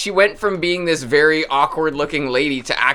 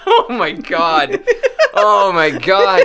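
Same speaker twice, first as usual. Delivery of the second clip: laughing; 7 semitones higher, 7 dB louder, slower than usual. Real, not acted. Laughing at the egg joke.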